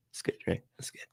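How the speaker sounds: ordinary talk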